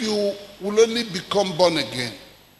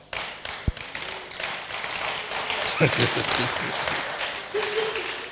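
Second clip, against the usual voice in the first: long unvoiced wheezing laugh; breathy silent laugh; 8 dB quieter than usual. Real, not acted. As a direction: laugh soundlessly at your own joke. Laughs after joking at audience.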